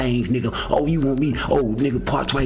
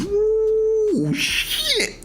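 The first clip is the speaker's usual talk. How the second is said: high, sudden yelp of alarm; a high comic woo of alarm; mock startled yelp. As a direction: yelp it like the move just went wrong. Retelling reaction to failed move.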